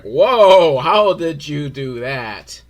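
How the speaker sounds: loud, drawn-out mock amazement; amazed drunken audience. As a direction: gasp it slowly like a wowed toddler. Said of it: "their brains are the level of".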